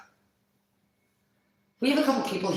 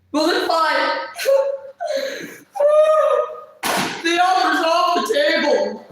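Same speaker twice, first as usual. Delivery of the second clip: high, loud laughing yell; laughing shout; 13 semitones higher, 7 dB louder, much slower than usual. Real, not acted. Yelling back at the chat, cracking up.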